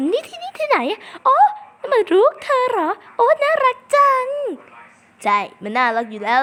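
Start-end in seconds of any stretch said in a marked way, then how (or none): 0.1-1.3 very high, squeaky and confused; squeaky character voice. Pretend. Voicing a character in game. 1.8-4.3 put-on very high, louder cooing over the baby; squeaky gushing character. Voicing granny character meeting baby.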